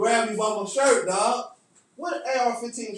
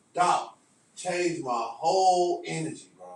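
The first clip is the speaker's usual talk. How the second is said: ordinary talk, nothing marked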